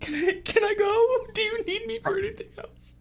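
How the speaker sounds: high falsetto, hesitant start; timid Jabaz. Acted. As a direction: ask timidly in a squeaky voice. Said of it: "she's like".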